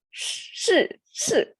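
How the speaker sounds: two separate 是 syllables, tongue curled back, both in falling tone. Shown as a demonstration. Teacher tries the hard-to-pronounce word, 是 curled-tongue and falling. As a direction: say 是, 是 with curled tongue, each falling sharply from high. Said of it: "they are both falling tone".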